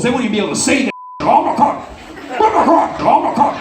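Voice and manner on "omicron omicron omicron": mock horror-movie voice; spooky mock announcer. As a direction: ham up the scary sound of it. Hamming up the scary name.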